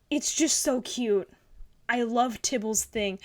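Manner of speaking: ordinary delivery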